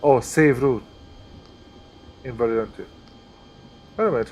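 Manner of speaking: normal talking voice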